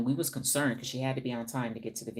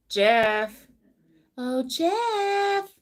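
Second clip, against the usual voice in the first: high, loud drawn-out call; calling Jeff; 11 semitones higher, 10 dB louder, much slower than usual. Shown as a demonstration. Calling out to a viewer named Jeff. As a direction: sing out his name like calling a lover. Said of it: "That's how you call him".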